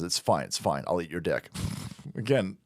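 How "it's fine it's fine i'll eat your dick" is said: much lower, softer mock judge; meek, low, giving in. Vlogger imitates the judge shrugging off the insult, low and meek. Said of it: "The judge says".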